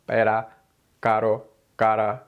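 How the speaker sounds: pera, caro, cara with a single tapped r; one quick tongue flap on each r; tap sound. Shown as a demonstration. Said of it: "make the tap sound".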